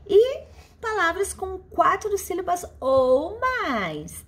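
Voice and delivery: normal talking voice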